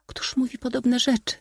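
very high, ladylike reproach; reproachful princess. A narrator's reading. Princess scolding the suitor's tactless words, high-voiced. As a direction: chide in a high feminine voice. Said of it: "szepnęła Iza".

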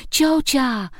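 put-on high-pitched yell of a little boy; high, drawn-out childish holler; child roaring. A narrator's reading. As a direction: wail it out as an angry five-year-old demanding attention. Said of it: "ryczał na całego".

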